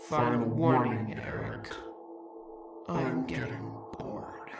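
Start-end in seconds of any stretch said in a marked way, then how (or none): 2.9-4.5 bored creature whisper. Acted. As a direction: breathe it out, unvoiced and bored.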